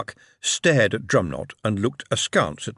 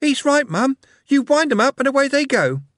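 put-on small boy voice; 5 dB louder than usual. A narrator's reading. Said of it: "The smaller boy".

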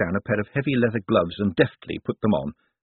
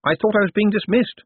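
higher, louder feigned puzzlement; playing along; 12 semitones higher, 5 dB louder than usual. A narrator's reading. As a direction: play along innocently, pitched up. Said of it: "said Moist, playing along".

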